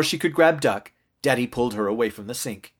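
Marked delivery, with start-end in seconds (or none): none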